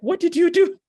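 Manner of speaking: astonished question. Retold or imitated. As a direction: blurt it out in total disbelief. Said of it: "she's like".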